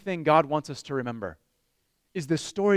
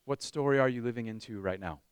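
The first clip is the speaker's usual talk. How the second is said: quiet repeat; 7 semitones lower, 8 dB quieter than usual. Shown as a demonstration. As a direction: ask it again gently. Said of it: "question asked twice".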